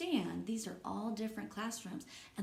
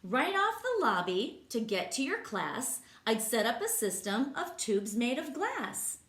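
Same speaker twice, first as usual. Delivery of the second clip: lively, sing-song read-aloud voice; reading to children; 5 semitones higher, 6 dB louder than usual. Acted. Teacher reading a rhyming book to kids, lively. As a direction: bounce the rhymes for the class.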